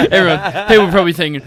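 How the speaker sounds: breaking into loud laughter mid-sentence; laughing. Real, not acted. Laughing at own answer.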